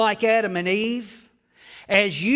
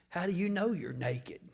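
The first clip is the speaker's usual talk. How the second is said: unvoiced whisper; whispered question; 2 semitones lower, 9 dB quieter than usual. Preacher voicing God's question, whispered. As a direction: ask it under your breath. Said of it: "Listen to God's second question".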